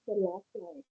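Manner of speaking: faint, far-off voice; very soft, almost lost; barely audible. Real, not acted. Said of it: "You sound so faint".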